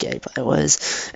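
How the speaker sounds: hushed agreement to yourself; soft, inward aside; inner thought. Retold or imitated. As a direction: murmur it as a private thought. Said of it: "in my head, I was like".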